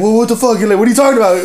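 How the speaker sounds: high, alarmed protest in retelling; horrified. Retold or imitated. Carlos confronted by the dude, acted horrified. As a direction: act out baffled horror. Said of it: "Fucking horrified".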